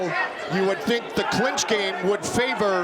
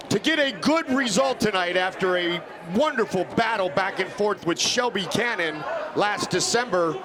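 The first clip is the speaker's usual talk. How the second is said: ordinary delivery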